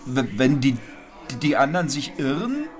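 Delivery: high, halting stammer; stammering young man. A narrator's reading. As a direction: stumble over the words nervously.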